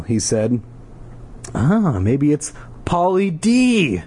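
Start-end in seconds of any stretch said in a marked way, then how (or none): 1.5-4.0 [name] voice. Pretend. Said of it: "do a [name] voice".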